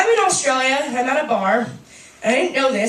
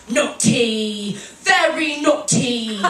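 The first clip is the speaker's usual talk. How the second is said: slow, drawn-out coddling telling-off; mother's mild scolding; 2 semitones lower, much slower than usual. Retold or imitated. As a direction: scold a toddler far too gently, stretching each word. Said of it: "she looks him in the eye, and she goes".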